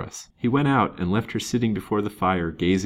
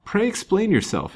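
high-pitched, perplexed plea; puzzled and insistent, pitched well up; baffled demand; 13 semitones higher than usual. A narrator's reading. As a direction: press for an explanation. Baffled man demands an explanation.

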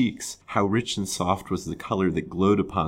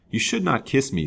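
a touch of petulant protest, slightly raised; pettish; 7 semitones higher than usual. A narrator's reading. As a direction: push the kiss away with mild pettishness. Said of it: "said she pettishly".

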